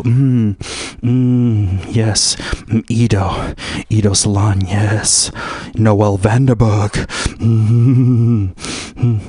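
low, relishing hums and murmurs; low-pitched, self-pleased musing; savoring. Acted. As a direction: linger dreamily over each name, low. Acts himself relishing the salon girls.

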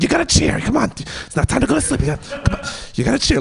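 high coaxing to toddlers; mock coaxing Darcy. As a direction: egg the babies on. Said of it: "She's just like".

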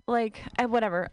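ordinary talk

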